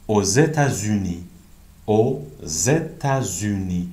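"Aux États-Unis" slow, with the x sounded as z, "o zétazuni"; liaison with z. Shown as a demonstration. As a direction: say "Aux" slowly and link it to "États-Unis" with a clear z. ("Aux États-Unis" آهسته، ایکس به صورت ز)

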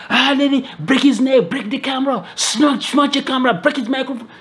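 high mock yelling of an angry crowd; imitating a furious crowd yelling; crowd screaming. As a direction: shout it the way the mob did, pitched high. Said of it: "People are screaming, yelling".

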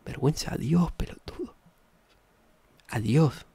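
hushed, secretive whisper. Real, not acted. Streamer whispering a punchline to chat, hushed. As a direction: drop to a conspiratorial whisper.

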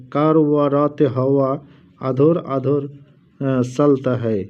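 slow, careful reading of "কারওয়ারাতে হাওয়া আধর আধর"; reading the pronunciation. Shown as a demonstration. Showing viewers how the Urdu mantra is pronounced, slow and careful. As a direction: sound out "কারওয়ারাতে হাওয়া" slowly, then "চলতা হে". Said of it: "উচ্চারণ দেওয়া আছে".

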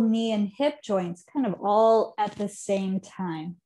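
ordinary delivery

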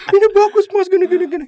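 high, loud, pleading junior. Retold or imitated. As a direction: raise the voice into a tearful junior's plea. Junior voiced defending drawing. (meniru suara junior yang tinggi dan memelas)

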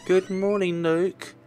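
put-on high, bright child greeting. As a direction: chirp it like a little girl.

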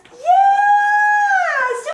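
a high, loud burst of praise; excited praise. Real, not acted. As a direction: cheer the puppy on. Praising the puppy for coming.

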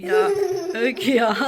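laughing. Real, not acted. Mother laughing at joke.

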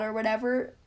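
ordinary delivery

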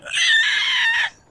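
very high loud screeching squawk; pterodactyl shriek. Pretend. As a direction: shriek like a flying reptile. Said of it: "That sounds like a pterodactyl".

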